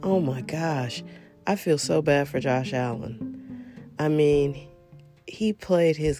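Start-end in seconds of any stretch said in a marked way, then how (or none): none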